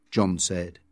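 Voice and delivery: ordinary delivery, nothing marked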